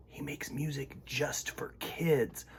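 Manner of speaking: put-on hushed secret whisper to the kids. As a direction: lean in and whisper it.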